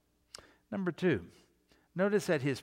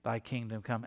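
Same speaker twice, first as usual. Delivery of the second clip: low, soft, empty recitation; mumbled rote prayer; 6 semitones lower, 5 dB quieter than usual. Retold or imitated. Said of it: "they're going".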